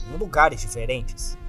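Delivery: ordinary delivery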